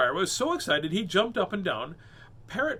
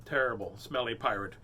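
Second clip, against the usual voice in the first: ordinary delivery, nothing marked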